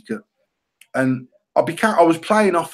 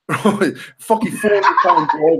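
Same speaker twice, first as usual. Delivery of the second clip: laughing; 4 semitones higher than usual. Real, not acted. Laughing at his own failure.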